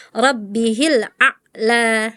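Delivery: RABBIHIL A'LA with a dead stop after A'; chopped ain. Retold or imitated. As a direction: break A'LA abruptly before LA. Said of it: "Jangan disaktah".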